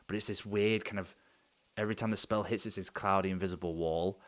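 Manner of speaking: usual speaking voice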